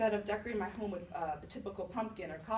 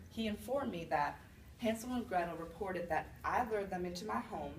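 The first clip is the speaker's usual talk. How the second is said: usual speaking voice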